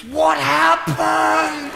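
put-on high, drawn-out child's cry; slow, high-pitched mock astonishment; shocked little boy. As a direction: imitate a freaked-out kid, stretching the words. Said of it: "this little boy came up to me".

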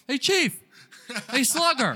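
high, loud joke holler; mock hailing yell. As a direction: call out like the yelling stranger. Joking variations on the yelled hail.

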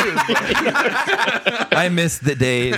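put-on slow, high old-timey sigh; drawn-out nostalgic old-timer; old-timey wistful voice. Newsies joke, old-timey voice.

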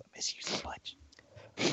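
slow unvoiced whisper. Real, not acted. A fan missing the former coordinator, slowly whispered. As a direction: breathe it slowly, almost tearful. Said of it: "whispered aside to coach".

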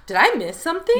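high, loud bewildered question; acted double take, pitched well up; startled confusion. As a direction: blurt it out as if suddenly lost. Acting out her startled confusion.

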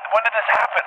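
high, baffled retold question; retold bafflement. Dreamer asking himself about the video, retold bafflement.

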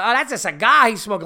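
high, loud scornful scoff; mocking rude woman. Comedian mimics the rude woman waving off the cigar, high and loud. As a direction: mimic her scornful dismissal. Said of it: "fucking absolute disrespectful".

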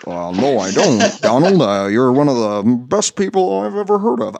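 measured presidential impression; mock statesman reassuring a friend; Obama voice. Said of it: "my Obama voice for Abraham Lincoln".

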